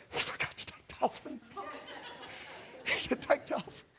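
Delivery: hushed, voiceless whisper passed along the pews; acted stage whisper among the congregation. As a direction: whisper it like a scandalized churchgoer. Congregation murmuring to each other mid-service.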